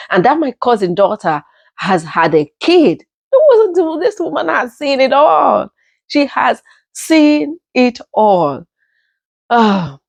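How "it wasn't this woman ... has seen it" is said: a touch of awe; emphatic. Real, not acted. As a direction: stress "She has seen it all" firmly. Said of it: "emphatic tribute".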